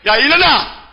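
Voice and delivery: high-pitched mock order to get up; playfully acted command; retold command.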